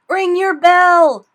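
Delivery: loud, high, slow call. Acted. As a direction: call up to the belfry. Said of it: "he called".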